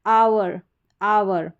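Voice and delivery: आवर with an open "aa" into "ver"; "ow-er" sound. Shown as a demonstration. Teacher repeats the word for students, "aa-ver". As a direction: say आवर as two beats, "aa-ver". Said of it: "ये इसका उच्चारण है".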